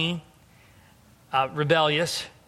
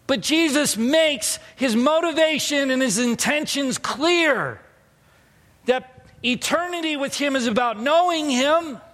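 high, driving preacher's delivery; impassioned preaching; 11 semitones higher, 5 dB louder than usual. Acted. Pastor driving home Jesus's intentions to the church, impassioned preaching. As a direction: preach it with raised pitch and long-held key words.

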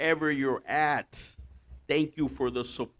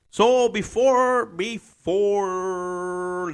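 loud drawn-out; 7 semitones higher, 8 dB louder, much slower than usual. Real, not acted. Loud stretched lead-in.

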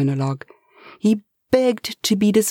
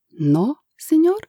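put-on pleading Spanish accent; 10 semitones higher than usual. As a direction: beg the boss in a Spanish accent. Eustasio surprised.

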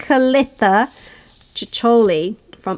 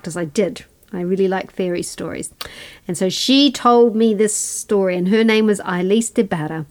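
ordinary talk

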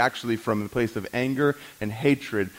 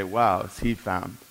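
drawn-out admiration; 6 semitones lower, slower than usual. Retold or imitated. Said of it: "we would look at the other and say".

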